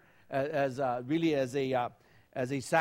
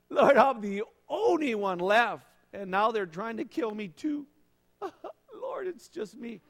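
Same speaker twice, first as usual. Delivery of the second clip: pity party; 7 semitones higher, 4 dB louder than usual. Retold or imitated. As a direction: overdo the martyr, then crack into a laugh. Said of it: "Kind of a little pity party with God".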